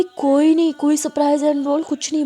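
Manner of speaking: loud, slow, no-nonsense put-down; mother cutting off the surprise excuse loudly and slowly; stern dismissal. Acted. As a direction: stamp out the excuse word by word.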